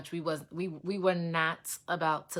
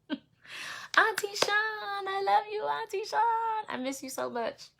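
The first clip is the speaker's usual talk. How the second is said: laughing greeting. Real, not acted. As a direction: gush over her aunt with a laugh. Greeting the aunt directly.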